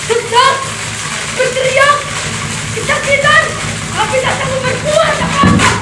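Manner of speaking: high, strained cries; pent-up agony. A narrator's reading. Narrator telling villagers of a bomb that cannot burst, pent-up agony.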